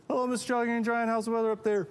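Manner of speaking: high monotone holler run straight through; little creature piping up to a giant; Trid calling up. Acted. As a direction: recite the hail in a high calling voice. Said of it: "every day we go".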